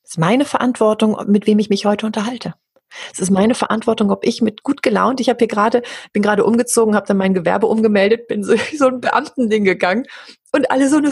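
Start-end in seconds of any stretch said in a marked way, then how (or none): none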